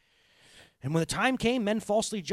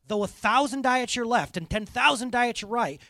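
fervent scripture reading; 6 semitones higher, 6 dB louder than usual. Acted. Reciting scripture with force.

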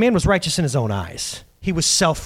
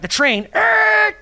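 sudden shout; much slower than usual. Real, not acted. Pastor suddenly shouting mid-sentence to his congregation.

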